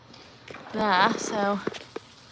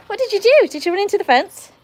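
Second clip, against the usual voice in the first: high-pitched cooing questions to a dog; pet-directed talk; 7 semitones higher, 5 dB louder than usual. Real, not acted. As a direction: quiz a silly dog in a high voice. Teasingly questioning her dog.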